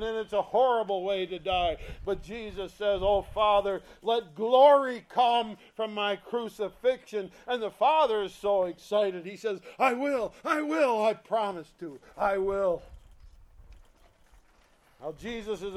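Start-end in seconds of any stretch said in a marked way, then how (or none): 3.0-7.3 loud, lifted prayer to Father; preacher voicing Jesus praying; fervent prayer. 9.8-12.8 eager, raised voice, repeating; the Father answering Jesus with excitement; excited promise. Acted.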